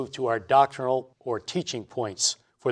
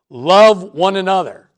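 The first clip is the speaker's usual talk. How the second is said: loud, raised-pitch parting order; emphatic command; 5 semitones higher, 8 dB louder than usual. Acted. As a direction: press it home like a parent's last instruction. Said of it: "left us with this emphatic command".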